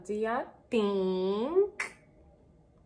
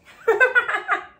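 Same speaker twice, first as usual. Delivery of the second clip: sudden high laugh, louder; high burst of laughter; 14 semitones higher, 9 dB louder than usual. Real, not acted. Admitting the lips are sore.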